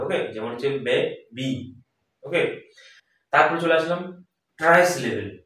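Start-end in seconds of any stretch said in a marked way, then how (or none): 0.8-1.7 বেবি split as বে, then বি; teacher's syllable demo of বেবি; one syllable at a time. Shown as a demonstration. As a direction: say বেবি in two slow, separate beats. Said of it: "এক একটা করে".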